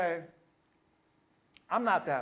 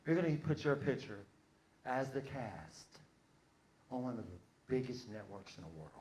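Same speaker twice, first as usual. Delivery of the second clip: eloquent pitch; 6 semitones lower, 9 dB quieter than usual. Acted. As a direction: act a smooth-talking producer, low and measured. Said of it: "came to me all eloquently".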